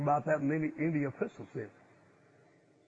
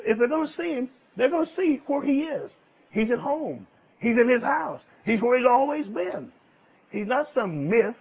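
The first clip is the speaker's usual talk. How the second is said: louder, higher preacher's build; fervent preaching; 6 semitones higher, 5 dB louder than usual. Acted. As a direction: drive the point home to the church. Preaching at full force.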